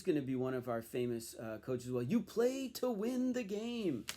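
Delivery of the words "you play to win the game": slow, higher, emphatic coach's sound bite; coach quote. Retold or imitated. Collector quotes the coach's famous line, slow and emphatic.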